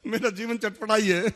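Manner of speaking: laughing. Real, not acted. Joking his life is spicy.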